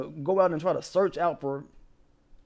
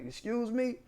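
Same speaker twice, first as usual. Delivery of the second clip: softer, pitched-up mock disbelief; incredulous excuse me; 7 semitones higher, 7 dB quieter than usual. Retelling the clerk's reply to the caller, quiet mock disbelief. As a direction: quietly replay the stunned "Excuse me?". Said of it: "Like that in response".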